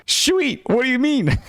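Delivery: mock-excited, pitched far up; over-excited high-pitched reply. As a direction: read the text in a squeaky-high eager voice. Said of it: "Very excited".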